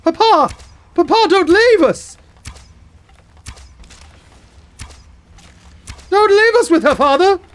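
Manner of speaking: high, loud melodramatic pleading; wailing followers. Acted. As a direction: beg papa not to go, hammed-up. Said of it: "they're all like".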